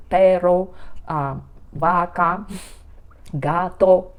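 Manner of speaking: "Perro, vaca, gato" in two distinct syllables each; two-syllable demo. Shown as a demonstration. Teacher showing that Spanish words have two syllables, "Perro, vaca, gato" syllable by syllable. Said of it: "in Spanish sound two syllables".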